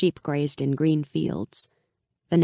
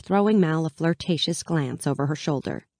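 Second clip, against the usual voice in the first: ordinary delivery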